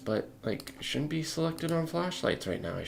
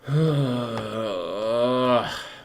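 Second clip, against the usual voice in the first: exasperated sigh; 4 dB louder than usual. Real, not acted. Exasperated at Blender.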